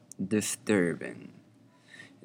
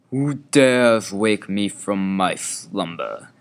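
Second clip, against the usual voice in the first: slow, booming voice from beyond; sleeping ancient god; 8 dB louder, slower than usual. Acted. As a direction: boom it out like a woken ancient god. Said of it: "questioned a disembodied voice".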